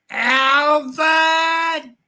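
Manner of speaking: very high squeaky Alvin call; chipmunk voice effect. Shown as a demonstration. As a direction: shout the name like a cartoon chipmunk.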